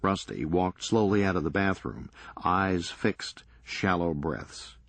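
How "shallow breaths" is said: plain reading voice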